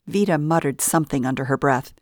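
ordinary delivery, nothing marked

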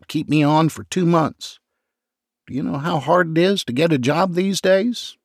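high, begging voice; mild hammed-up sobbing plea; pleading, near tears. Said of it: "McCracken sobbed louder".

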